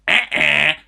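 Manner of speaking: loud nasal "eh-eh" buzz; a loud wrong-answer buzz; mock buzzer. Real, not acted. Mocking rejection of lyrics.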